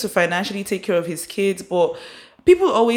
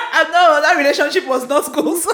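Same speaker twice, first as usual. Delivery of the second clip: laughing; 8 semitones higher, 4 dB louder than usual. Real, not acted. Laughing at ex remark.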